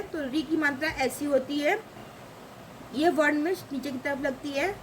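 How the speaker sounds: ordinary talk, nothing marked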